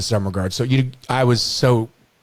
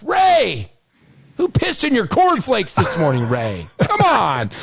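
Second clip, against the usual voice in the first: high, put-on voice, fed up; exasperated comeback; 11 semitones higher, slower than usual. Player Rock snapping back at coach Ray, high fed-up voice. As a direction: toss it back at the coach, half laughing.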